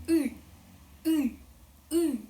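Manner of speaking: short grunted U sounds as if poked in the stomach; punched-in-the-stomach U. Shown as a demonstration. Teacher demoing U, pretending to be punched in the stomach. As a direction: push the U out like you just got poked in the belly. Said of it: "let's play the actor who was poked in the stomach".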